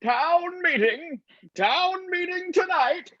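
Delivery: slow, high blaring loudspeaker call; PA announcement. Acted. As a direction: call it out over a PA system. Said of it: "P A system blaring".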